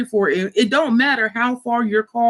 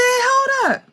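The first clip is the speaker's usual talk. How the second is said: stunned hold up. Retold or imitated. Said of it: "So I said".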